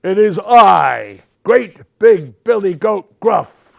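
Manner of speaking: very loud, slow big-goat voice; great big shout. A narrator's reading. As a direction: boom each word out proudly. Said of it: "shouted the biggest goat in his great big voice".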